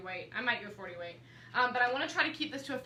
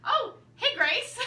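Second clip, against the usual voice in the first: much higher and louder, bright surprised hello; happy greeting; 13 semitones higher, 7 dB louder, slower than usual. Real, not acted. Greeting Grace as she walks in, loud happy hello. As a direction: call out a delighted hi.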